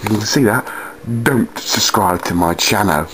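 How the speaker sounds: ordinary talk, nothing marked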